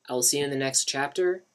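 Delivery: plain reading voice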